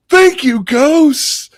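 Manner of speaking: very high, loud mock delight; overjoyed thanks. Promoted man to the ghosts, high loud squeal. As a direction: squeal it out as a punchline.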